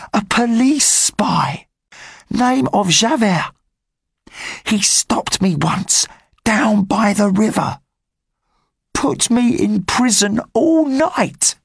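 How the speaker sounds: put-on high-pitched cheeky boy's voice; street boy Gavroche. Gavroche warning the rebels about the spy, high boyish voice. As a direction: talk up high like a scrappy street kid.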